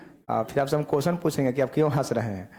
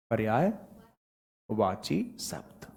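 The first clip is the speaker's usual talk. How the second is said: slow dictation; 5 semitones lower, 6 dB quieter, much slower than usual. Shown as a demonstration. Teacher dictates while writing.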